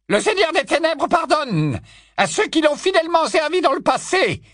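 high, haughty witch voice; lofty and scornful; Bellatrix's haughty tone. Acted. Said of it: "magnifique imitation du ton le plus hautain de Bellatrix".

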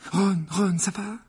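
high, anxious check; concerned, high-pitched question; worried. Acted. Friend worries over fallen Ron.

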